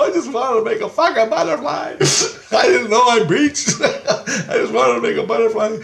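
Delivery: high-pitched, helpless laughing plea; laughing protest. Retold or imitated. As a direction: protest your innocence through laughter. Laughing through retold quarrel.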